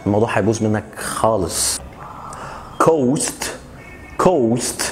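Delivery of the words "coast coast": coast said with a long round o. Shown as a demonstration. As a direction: stretch the o in coast. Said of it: "coast يعني الساحل".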